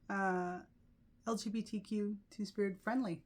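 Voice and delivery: ordinary talk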